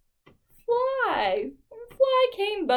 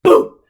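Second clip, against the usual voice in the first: boom sound; 14 dB louder than usual. Acted. Goat butts wolf.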